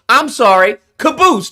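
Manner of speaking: usual speaking voice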